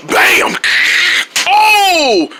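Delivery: high yelp then slow groaning complaint; pained yelp. Pretend. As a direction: play the hit for laughs. Villain hit in the spleen.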